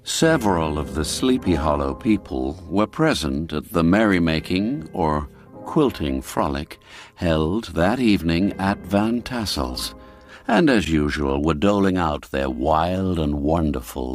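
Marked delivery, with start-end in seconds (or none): none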